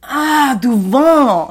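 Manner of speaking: loud, high, exaggerated French "devant"; mock a French person suddenly getting it; mock French comprehension. French visitors correcting a museum worker, hammed-up realization. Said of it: "And they're like".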